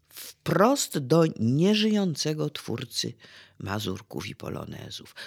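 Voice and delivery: ordinary talk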